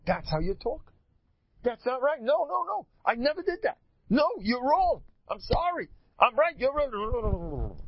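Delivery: high-pitched mock indignation, rapid protests; acted quarrel. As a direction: bicker back in a high, worked-up voice. Acts out the usual marital quarrel.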